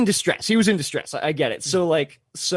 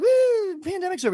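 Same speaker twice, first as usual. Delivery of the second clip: much higher, shrugging mock voice; mocking carefree voice. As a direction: mimic the naive crowd dismissively. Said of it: "everybody's like".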